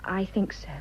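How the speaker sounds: breathed softly, no voice; whisper. Acted. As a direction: admit it in a hushed whisper. Lovers' hushed admission.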